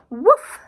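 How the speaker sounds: loud, rising woof bark; loud yappy woof; dog's woof. Acted. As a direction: bark it out like a small dog.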